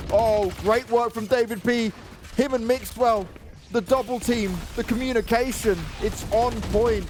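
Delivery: high-pitched esports call; punchy, thrilled live commentary; excited play-by-play. Real, not acted. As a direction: call the round with rising excitement, voice held high.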